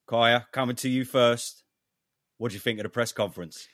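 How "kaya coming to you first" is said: loud. Real, not acted. Host hands over to guest.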